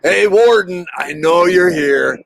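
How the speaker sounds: hollering into the dark woods, playful; old John calling to the hidden warden, loud; retold yell. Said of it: "old John steps out and yells".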